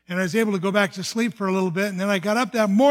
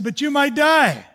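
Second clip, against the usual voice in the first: higher, louder and slow, an urgent warning; doctor's alarmed plea, raised and slowed; 7 semitones higher, 6 dB louder than usual. Retold or imitated. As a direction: press it on him, worried and insistent. Doctor warns of death.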